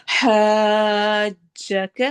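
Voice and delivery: slow chanted tajweed with a long drawn ha; Quranic recitation. Shown as a demonstration. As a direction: recite it, stretching the vowel. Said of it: "ہا کو لمبا کرتے ہوئے".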